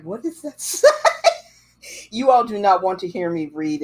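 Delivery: ordinary talk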